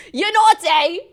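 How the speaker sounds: loud, high mock British accent. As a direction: squeal it in exaggerated British accent. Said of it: "so they have an accent".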